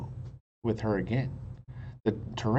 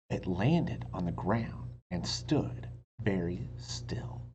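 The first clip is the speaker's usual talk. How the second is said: slow whisper; hushed narration; 6 semitones lower, 4 dB quieter, slower than usual. A narrator's reading. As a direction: hold the stillness in a whisper.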